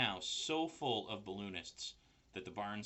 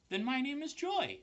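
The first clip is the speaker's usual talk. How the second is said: high small voice; little spider voice; 6 dB louder than usual. A narrator's reading. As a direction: spider naming itself. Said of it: "said the first spider".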